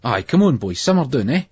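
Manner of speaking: calm him down with a steady, no-nonsense push; a mate settling the lads, level but firm; firm but reasonable. Acted. Carol to the boys, stopping a fight: firm but reasonable. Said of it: "firm but reasonable".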